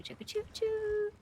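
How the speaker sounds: high, slow, drawn-out choo choo; chugging toy train voice. Pretend. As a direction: let the whistle blow long. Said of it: "if I really wanna build it up".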